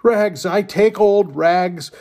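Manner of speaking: high, loud, slow street cry; the bleeding ragman crying out his trade, loud and high. Acted. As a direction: call it out long and high, a peddler's wail. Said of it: "cried the sobbing, bleeding".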